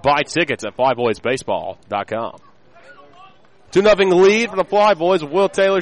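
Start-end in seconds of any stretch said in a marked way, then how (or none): 3.7-5.8 excited announcer. Real, not acted. Inning recap, repeated.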